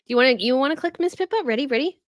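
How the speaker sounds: high, playful coaxing of a pet; coaxing pet talk. Real, not acted. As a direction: sing out an eager invitation to a pet. Coaxing her pet to click.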